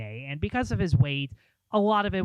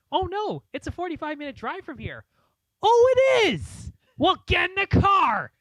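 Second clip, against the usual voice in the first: excited comedian; 4 dB louder than usual. Retold or imitated. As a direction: play a comic suddenly thrilled at the news. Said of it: "And he goes".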